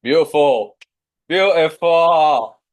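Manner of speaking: drawn-out, loud Yorkshire praise; Yorkshire accent. Real, not acted. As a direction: stretch it out in broad Yorkshire, loud. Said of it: "That's my Yorkshire accent coming through".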